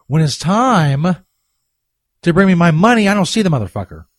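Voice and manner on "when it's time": slow, with time drawn out into a rise; slow teasing drawl. Shown as a demonstration. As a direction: stretch "when it's time" slowly, rising on time. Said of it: "repeats the phrase slower".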